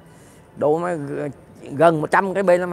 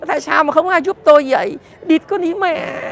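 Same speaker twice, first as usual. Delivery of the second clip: much higher, hammed-up panicked yell for help; mocking victim screeching, much higher, loud at first; mock cry for help; 10 semitones higher, 5 dB louder than usual. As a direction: parody a robbery victim shrieking to the village. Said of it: "Chúng mày đã la lên".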